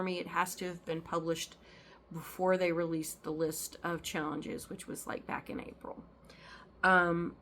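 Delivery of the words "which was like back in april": whisper. Real, not acted. Aside on the challenge timing.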